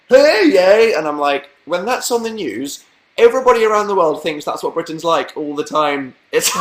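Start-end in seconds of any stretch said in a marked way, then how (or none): 0.1-0.9 loud, high mock proclamation; hammed-up ceremonial cry; town crier. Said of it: "when that town crier came out".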